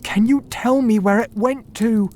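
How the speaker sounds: desperate to know where the goose went; anxious cry. A narrator's reading. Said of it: "he cried".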